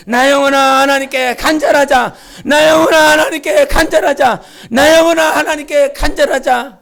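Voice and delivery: 세 번 크게 외치는 선포; 온 회중이 함께 외치듯 힘차게; shouted declaration. Shown as a demonstration. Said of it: "세 번 시작". (회중과 함께 세 번 외치는 큰 선포)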